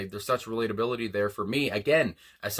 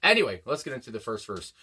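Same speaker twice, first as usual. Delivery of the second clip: ordinary talk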